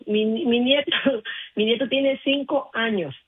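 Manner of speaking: usual speaking voice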